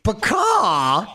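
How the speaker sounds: high squawking bird call; crane cry. Retold or imitated. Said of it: "He sounds like a crane".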